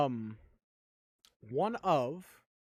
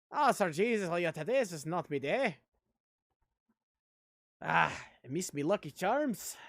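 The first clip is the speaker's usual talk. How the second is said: jokey stage Irish accent, a little louder; hammed-up Irish; 3 dB louder than usual. Shown as a demonstration. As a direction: overdo a cartoon Irish lilt. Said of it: "that wasn't Irish".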